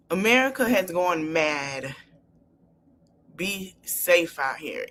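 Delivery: sincere plea. Real, not acted. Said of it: "pleading with listeners".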